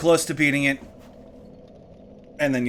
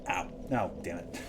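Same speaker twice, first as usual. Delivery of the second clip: muttered pain; 7 semitones lower, 11 dB quieter than usual. Real, not acted. Hurt himself mid-sentence.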